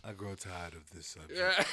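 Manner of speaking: bored ancient dragon. Pretend. Mock villain banter.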